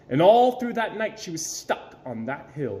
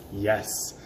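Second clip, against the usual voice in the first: ordinary delivery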